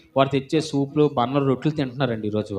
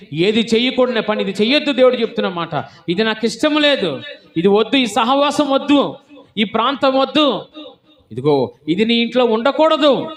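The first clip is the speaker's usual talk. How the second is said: higher, louder, rapid commanding sermon; fervent preaching; 9 semitones higher, 6 dB louder than usual. Acted. Preacher voices God forbidding sins, loud and urgent. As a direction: fire off God's prohibitions.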